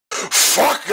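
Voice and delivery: high yelp of pain; exclaimed curse. A narrator's reading. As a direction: blurt it out hurt. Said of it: "I exclaimed".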